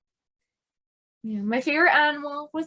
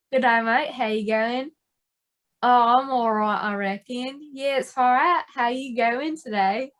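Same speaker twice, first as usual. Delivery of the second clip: put-on Aussie small talk; Australian greeting with rising questions; Australian accent. Shown as a demonstration. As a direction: play both sides in an Aussie accent. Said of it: "[name]'s accent, go".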